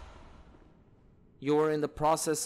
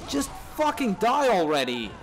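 frustrated yell; 6 semitones higher, 4 dB louder than usual. Real, not acted. Yelling at enemy in game.

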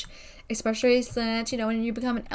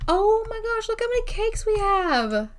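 amazed; 11 semitones higher than usual. Real, not acted. Spotting the pile of cakes, high amazed exclaim. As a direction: gasp it out at the stack of cakes. Finds fridge full of cakes.

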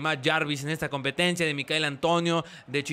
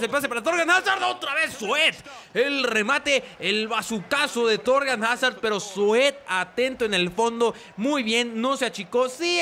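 higher, excited match call; live play-by-play; 6 semitones higher than usual. Acted. Commentator calling a shot and save, excited play-by-play. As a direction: leap up in pitch as the shot comes.

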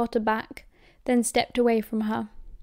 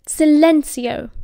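loud, drawn-out incantation; shouted spell; 4 semitones higher, 9 dB louder, slower than usual. Acted. Mother silencing her defiant son, shouted spell. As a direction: cast it loud and stretched out.

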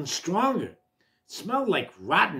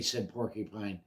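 ordinary delivery

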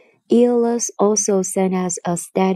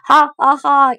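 loud, higher scornful laughter; mocking laugh; 7 semitones higher, 8 dB louder than usual. A narrator's reading. Neighbour giant mocking the Cyclops, loud scornful laugh. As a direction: laugh at the fool next door. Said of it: "heard him and laughed".

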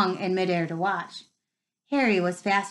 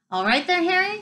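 a high, loud call across the pitch; yell; 9 semitones higher, 5 dB louder than usual. Acted. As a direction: shout it out in passing. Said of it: "he had time to yell".

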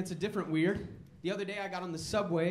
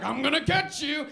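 playful threat; 8 semitones higher, 8 dB louder than usual. Retold or imitated. Friend chasing with spear.

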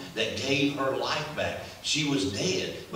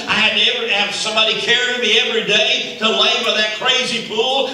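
rising to a loud high cry; preaching at full force; 10 semitones higher, 13 dB louder than usual. Acted. Preacher voicing the healed man cheering for Jesus, loud and high. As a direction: build from a claim to a shout.

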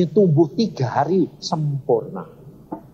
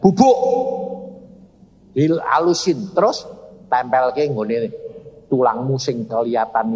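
God; 3 semitones lower than usual. Retold or imitated. God instructing the wife on treatment, commanding. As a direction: punch it out as a command. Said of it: "Tuhan ngomong gini".

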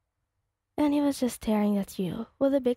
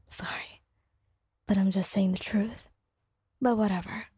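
hushed teasing whisper. Acted. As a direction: breathe it right into her ear. Kirishima whispers close.

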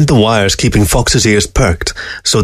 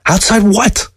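sharp, high demand; suddenly sharp; 11 semitones higher, slower than usual. A narrator's reading. Fox demanding to know what lies outside, sharp. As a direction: snap the question, much higher. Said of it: "Fox's voice was suddenly sharp".

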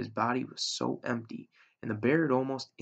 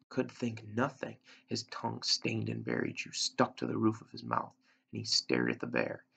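ordinary delivery

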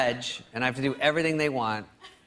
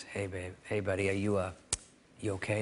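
soft brotherly concern; 5 semitones lower, 8 dB quieter than usual. Retold or imitated. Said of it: "he goes".

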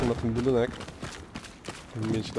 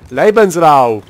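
mock German war cry; 2 semitones higher, 13 dB louder, much slower than usual.

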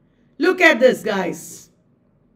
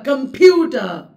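Computer with PU punched loud; louder stressed syllable; 2 semitones higher, 5 dB louder than usual. Shown as a demonstration. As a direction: hit the middle of comPUter harder. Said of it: "It's louder".

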